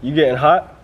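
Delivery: ordinary talk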